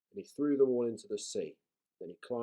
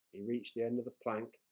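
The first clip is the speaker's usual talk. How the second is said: usual speaking voice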